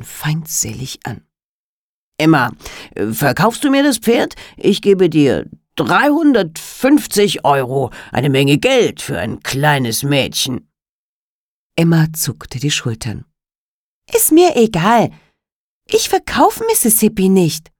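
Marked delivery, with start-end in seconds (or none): 2.2-10.7 sly, wheedling dealer; Alligator voice. Acted. Alligator bargains with the girl. 14.1-17.7 put-on higher, louder stubborn child; a little girl refusing flatly; defiant girl voice.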